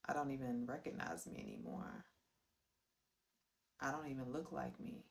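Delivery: low, soft and subdued; somber reflection. Real, not acted. Storyteller recalls looking at herself, quiet and somber.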